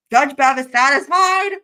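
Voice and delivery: high, loud, drawn-out squeal; losing it. Real, not acted. Repeats it, losing composure over innuendo.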